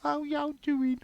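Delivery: high-pitched, exaggerated Brummie; Brummie accent. Podcaster mocking the Brummie accent to co-hosts, hammed-up high Brummie. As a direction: put on a squeaky mock Brummie voice. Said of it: "That Brummie accent's gotta be the worst".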